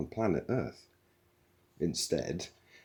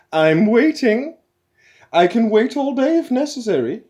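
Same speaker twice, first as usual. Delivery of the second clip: much higher, much louder stern nanny; stern matron; 14 dB louder than usual. A narrator's reading. Computer scolding the crew, loud high stern nanny. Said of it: "interrupted Eddie".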